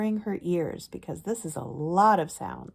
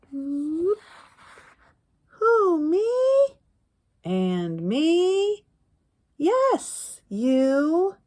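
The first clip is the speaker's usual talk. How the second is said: high, loud storybook voices; high playful picture-book voices; character voices; 9 semitones higher, 8 dB louder, much slower than usual. Acted. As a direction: act out the question and answer brightly.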